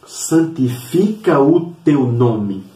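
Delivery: slow, drawn-out solemn invocation; solemn prayer. Retold or imitated. Jesus teaching prayer to the Father, solemn and slow. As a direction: stretch each word with reverence.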